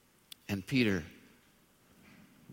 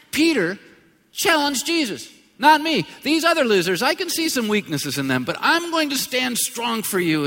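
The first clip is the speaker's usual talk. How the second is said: loud, high preacher's boast; preacher acting out a cocky boast; full-force preaching; 12 semitones higher, 11 dB louder than usual. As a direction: shout Peter's boast from the pulpit.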